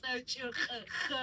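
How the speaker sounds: S, Ch, Ch, Ch as separate harsh consonant sounds; hard-sounding letters. Shown as a demonstration. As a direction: demonstrate S and Ch as hard Georgian throat sounds. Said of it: "kind of hard sounding letters".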